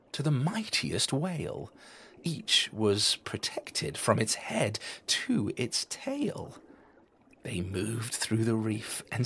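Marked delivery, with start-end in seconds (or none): none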